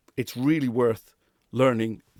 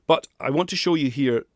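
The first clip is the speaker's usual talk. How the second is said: ordinary talk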